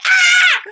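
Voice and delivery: very high, loud comic shriek; hammed-up panic scream; mock scream. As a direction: scream as if shoved out first. Mock scream at cosmic order.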